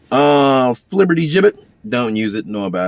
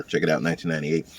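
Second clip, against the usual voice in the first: ordinary talk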